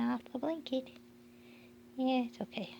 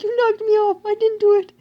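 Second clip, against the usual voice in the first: voicing the puppy; 10 semitones higher, 19 dB louder than usual. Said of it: "He's like".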